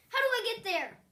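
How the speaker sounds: urgent interruption. A narrator's reading. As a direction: cut in eagerly, desperate to see her. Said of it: "Juan broke in".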